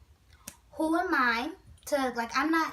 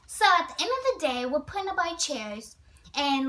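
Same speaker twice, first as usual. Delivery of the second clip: ordinary delivery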